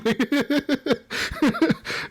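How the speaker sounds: long breathy high-pitched laugh. Real, not acted. Gamer finishing the story, laughing high. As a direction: let the laugh run.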